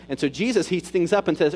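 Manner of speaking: usual speaking voice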